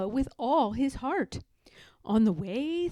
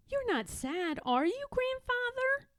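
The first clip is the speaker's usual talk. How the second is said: worried little cub. Acted. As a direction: ask gently like a small worried cub. Little Bear asking.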